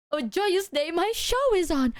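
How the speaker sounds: high, hammed-up joy; theatrical delight; mock joyful. Mock celebration.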